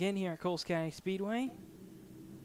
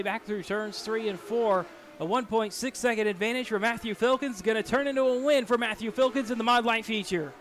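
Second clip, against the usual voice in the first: louder, energetic race call; live play-by-play; 4 semitones higher, 5 dB louder than usual. Real, not acted. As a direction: call the final laps live.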